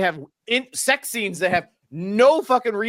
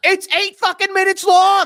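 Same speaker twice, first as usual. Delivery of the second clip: much higher and louder exasperated rant; exasperated outburst; 13 semitones higher, 6 dB louder than usual. Real, not acted. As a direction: blow up over how long it drags. Ranting about the long intro.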